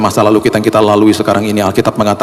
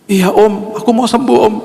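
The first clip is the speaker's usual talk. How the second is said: a lightly voiced small child; 12 semitones higher than usual. Sick boy answering the pastor, lightly voiced child. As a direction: answer like a hopeful little kid.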